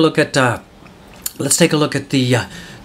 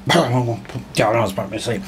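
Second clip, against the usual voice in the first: lower, softer, slow sleepy drone; mock bored; 5 semitones lower, 6 dB quieter, slower than usual. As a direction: act dozing off from boredom. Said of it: "I'm so bored".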